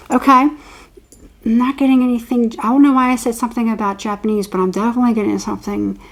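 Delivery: ordinary talk, nothing marked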